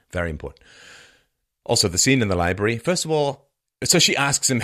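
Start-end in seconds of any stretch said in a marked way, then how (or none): none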